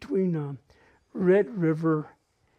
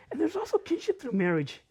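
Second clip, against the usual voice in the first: usual speaking voice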